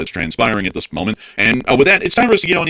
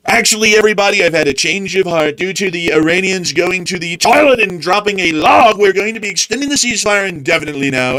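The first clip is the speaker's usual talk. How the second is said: mock leader announcement; 7 semitones higher than usual. Mocking the leader's last-minute ceasefire reversal, high pompous voice. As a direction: parody a leader backing down grandly.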